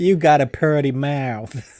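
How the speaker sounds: loud, high, drawn-out mock Southern accent. Co-host mocking the host's accent slip, exaggerated Southern twang. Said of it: "was that like".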